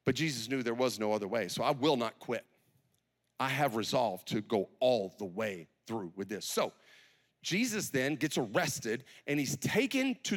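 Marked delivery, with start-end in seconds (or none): 1.6-6.5 low-pitched, steady vow; resolute. Acted. Jesus vowing to go all the way through, resolute. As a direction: state it low and settled, with resolve. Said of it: "The resolve Jesus had".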